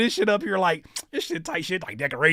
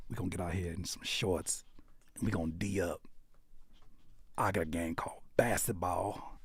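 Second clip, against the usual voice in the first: hushed; 10 semitones lower, 15 dB quieter than usual. Pretend. Hushed mock announcement.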